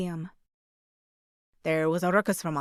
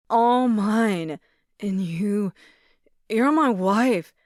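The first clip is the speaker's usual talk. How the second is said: put-on drunk, loud; 2 semitones higher, 7 dB louder, much slower than usual. A narrator's reading. Drunk ex throwing arms out.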